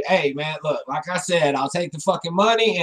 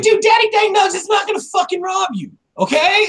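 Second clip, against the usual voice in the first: mock shout; 12 semitones higher than usual. Retold reassurance to friend.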